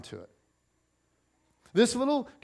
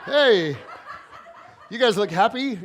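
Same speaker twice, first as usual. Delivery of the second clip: louder, laughing greeting; laughing hey; 4 semitones higher, 8 dB louder than usual. Retold or imitated. Greeting the kid's parents at pickup, laughing. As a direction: greet them while cracking up. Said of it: "you're just like".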